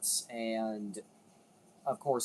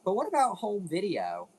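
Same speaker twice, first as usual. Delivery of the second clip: higher, louder eager question; imagined viewer question; 7 semitones higher, 5 dB louder than usual. Retold or imitated. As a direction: voice a curious viewer asking. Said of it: "wondering slash thinking".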